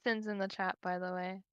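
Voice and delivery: ordinary talk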